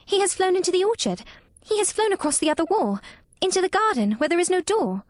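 put-on high-pitched girl, excitedly following the bird; excited cry. A narrator's reading. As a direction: call each new sighting in a child's voice. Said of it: "Mary cried out, watching him".

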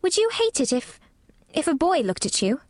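put-on high, hesitant girl's voice; tentative child, pausing before she finishes; uncertain. A narrator's reading. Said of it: "Mary asked uncertainly".

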